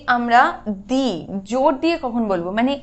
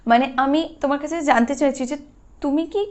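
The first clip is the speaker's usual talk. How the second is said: ordinary talk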